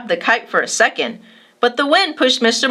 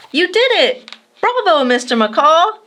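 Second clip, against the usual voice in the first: high, loud, cheering; child cheering as the kite flies, excited; 8 semitones higher, 3 dB louder than usual. A narrator's reading. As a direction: cheer him on with joy. Cheering the kite's flight.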